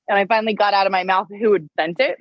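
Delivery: ordinary talk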